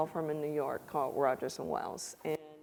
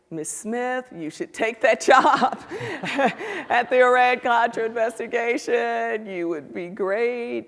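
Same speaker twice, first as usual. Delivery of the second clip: higher and louder, laughing through the words; 5 semitones higher, 9 dB louder than usual. Retold or imitated. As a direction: chuckle while urging her. Bill laughing on phone.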